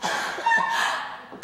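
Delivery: high, breathy burst of laughter; short high giggle; acted laugh. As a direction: laugh as the girl you once were. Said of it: "I used to laugh".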